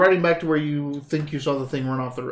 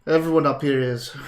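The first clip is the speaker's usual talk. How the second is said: ordinary talk, nothing marked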